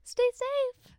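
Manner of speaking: very high, loud goodbye; high-pitched farewell. Acted. Lily Pansy seeing friends off, high loud farewell.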